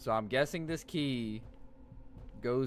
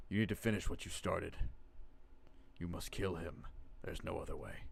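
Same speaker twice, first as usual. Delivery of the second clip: low, soft ominous warning; low grave voice; 7 semitones lower, 9 dB quieter than usual. Acted. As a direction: read it like a dying message. Voiced note from friend.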